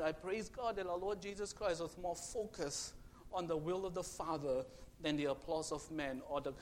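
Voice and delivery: ordinary talk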